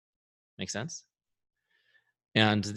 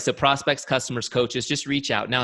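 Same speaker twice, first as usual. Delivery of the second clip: normal talking voice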